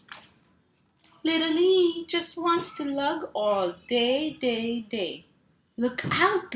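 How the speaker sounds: lively picture-book read-aloud with sound words; reading to children. Acted. Mom reading a truck book to kids, playful. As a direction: bounce the rhythm for little listeners.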